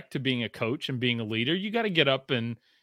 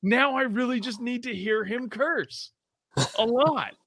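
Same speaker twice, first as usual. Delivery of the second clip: giddy wish; 8 semitones higher than usual. Retold or imitated. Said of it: "I'm sitting there going like".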